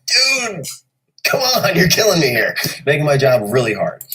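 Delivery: high, loud pleading complaint; loud exasperated sound engineer; exasperated audio guys. Retold or imitated. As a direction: beg him to stop peaking the meters. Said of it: "And they're just like".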